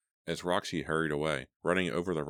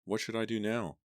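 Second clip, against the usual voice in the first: fearful; 6 semitones higher than usual. A narrator's reading. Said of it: "unable to keep the fear from her voice".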